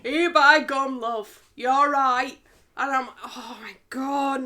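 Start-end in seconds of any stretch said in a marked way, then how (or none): 0.0-2.4 loud, broad Yorkshire greeting; Yorkshire accent. Retold or imitated. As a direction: ham up your Yorkshire accent. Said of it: "Yorkshire accents are used as comedic effect".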